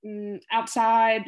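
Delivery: slow, halting words with long hesitations between them; imitating a patient searching for words; halting broken speech. Shown as a demonstration. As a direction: struggle to push each word out. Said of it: "they kind of break it up".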